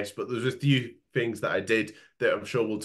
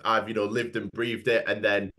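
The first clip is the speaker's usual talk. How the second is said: ordinary talk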